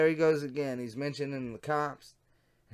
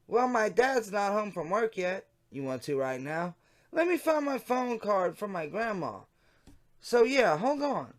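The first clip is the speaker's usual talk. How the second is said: lightly voiced young girl, higher; teen girl decoy; 7 semitones higher, 3 dB louder than usual. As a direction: read the decoy's lines a bit higher and brighter.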